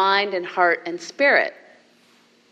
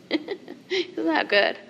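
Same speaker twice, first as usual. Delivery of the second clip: laughing aside; 8 semitones higher, 5 dB quieter, much slower than usual. Real, not acted. Delighted aside after the verse.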